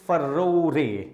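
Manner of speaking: furore said very slowly as three syllables, fu-ro-re, with the final e sounded; unusual pronunciation. Shown as a demonstration. Teacher showing students the unusual way to say furore, slow and careful. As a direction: stretch out furore into fu-ro-re, ending on a clear re. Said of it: "इसका pronunciation बड़ा unusual है".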